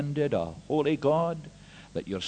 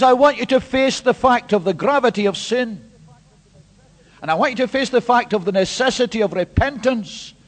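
louder, driving each demand home; forceful preaching; 6 semitones higher, 5 dB louder than usual. Acted. Preacher voicing James to the congregation, forceful. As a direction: declare it like a prophet.

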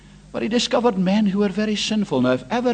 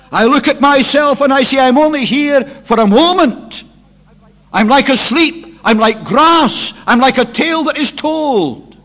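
fervent preaching; 8 semitones higher, 10 dB louder than usual. Acted. Preacher voices Moses before God for the congregation, loud and fervent. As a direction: thunder it out as Moses' confession.

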